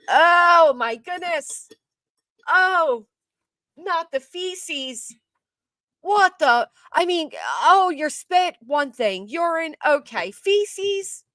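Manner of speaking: high, loud squeals of revulsion; disgusted shock. Real, not acted. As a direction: recoil at each word in horror. Reacting in disgust to the news.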